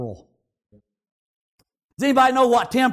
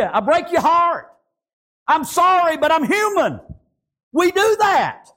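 impassioned, raised sermon delivery; fervent preaching; 7 semitones higher than usual. Real, not acted. Preacher telling the congregation God never lets you down, fervent preaching. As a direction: drive each phrase home to the congregation.

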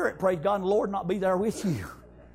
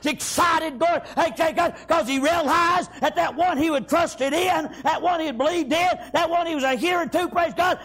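driving, raised preacher's cadence; fervent shouted sermon delivery; preaching at full force; 5 semitones higher than usual. Acted. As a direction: preach it hard, phrase after phrase.

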